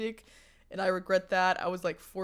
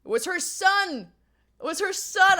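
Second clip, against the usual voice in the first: higher, louder, emphatic reveal of the punchline; mortified reveal; 10 semitones higher, 10 dB louder than usual. Real, not acted. Telling chat the awful twist, mortified and emphatic. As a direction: land the embarrassing twist hard. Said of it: "guess who that person was".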